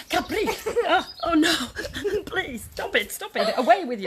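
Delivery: pleading through high giggles; laughing, begging the sheep to stop. Real, not acted. Presenter laughing as Big Sheepy climbs on her head, giggling pleas.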